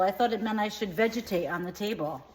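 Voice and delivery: normal talking voice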